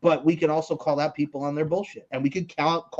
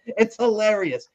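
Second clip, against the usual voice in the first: usual speaking voice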